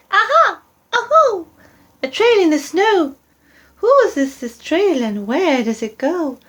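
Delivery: put-on high eager child creature; Gruffalo's child. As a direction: squeak it out like a curious young monster.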